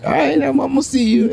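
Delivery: high, camp, flirty voice; flirty admirer. Retold or imitated. The admirer at the slam flirting with the poet, high and camp. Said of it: "He's like".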